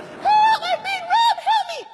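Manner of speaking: hammed-up high-pitched scream; screaming lady. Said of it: "she screamed even louder".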